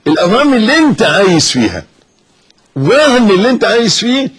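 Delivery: climbing, forceful retort; rising rebuke. Real, not acted. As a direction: hurl it back at the doubter. Preacher rebukes the skeptic.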